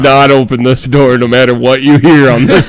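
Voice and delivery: cracking up while playing the enforcer; laughing mock order. Man telling staff to guard the door, laughing mock order. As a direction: give the order through laughter.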